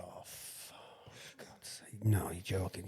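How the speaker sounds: breathless whispered swearing; shocked whisper. Retold or imitated. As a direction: whisper it, stunned by the news. Shocked in bed at night.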